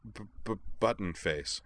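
halting start, stuck on the first sound; stammering. A narrator's reading. Kate explains the red mark on her cheek, stammering. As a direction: stutter on the opening B.

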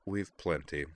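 dry, unimpressed offer; understated dry wit. A narrator's reading. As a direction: offer it deadpan. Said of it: "Amanda said dryly".

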